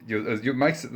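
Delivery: ordinary talk, nothing marked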